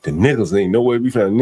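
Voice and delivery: usual speaking voice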